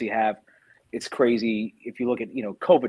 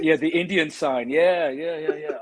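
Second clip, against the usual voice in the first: light British accent; 4 semitones higher than usual. Shown as a demonstration. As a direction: put on a British voice. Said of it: "coming up with all the Britishisms".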